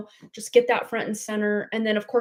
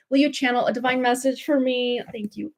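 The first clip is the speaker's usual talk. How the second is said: normal talking voice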